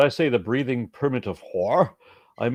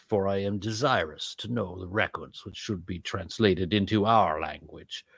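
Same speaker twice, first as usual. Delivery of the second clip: slow, sinister Sith lord intoning scripture; Darth Sidious; 2 semitones lower, 3 dB quieter than usual. Acted. Host reading ancient verses as requested, Sith lord voice. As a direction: draw out each phrase like an evil emperor. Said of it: "that should be Darth Sidious".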